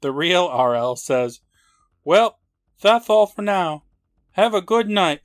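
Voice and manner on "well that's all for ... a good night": loud, high cheery announcer; host sign-off. Retold or imitated. Host's sign-off, retold.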